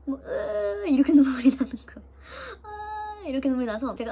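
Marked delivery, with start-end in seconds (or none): 0.2-0.9 high crying whimper. Retold or imitated. Crying on the bed transfer. 2.6-3.2 soft high sob; crying wail. Retold or imitated. Crying on the bed transfer.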